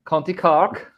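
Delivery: high, lilting bad Cork accent; terrible Cork accent. Shown as a demonstration. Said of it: "That's a terrible accent".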